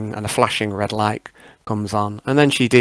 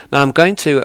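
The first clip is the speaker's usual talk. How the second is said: ordinary talk, nothing marked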